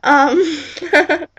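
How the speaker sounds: high, loud burst of laughter; real giggle. Real, not acted. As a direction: break up laughing, half sobbing. Giggling apology at the end.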